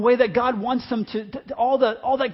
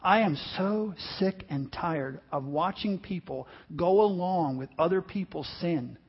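ordinary talk

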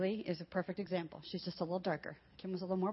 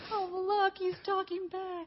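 high, slow cooing grandparent voice; doting grandparent; 11 semitones higher, slower than usual. Retold or imitated. Grandparent delighted at a sassy toddler, high and cooing. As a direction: coo over a naughty toddler.

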